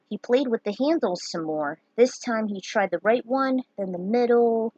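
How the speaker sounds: usual speaking voice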